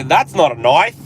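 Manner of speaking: put-on loud, swooping Aussie accent; hammed-up Australian movie quote; mock Australian accent. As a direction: exaggerate the Australian vowel in "knife". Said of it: "we always say".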